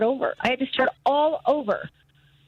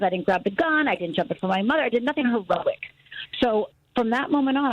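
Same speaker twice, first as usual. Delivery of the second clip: usual speaking voice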